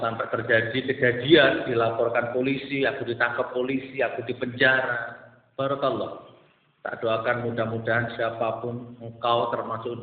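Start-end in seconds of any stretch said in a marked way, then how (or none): none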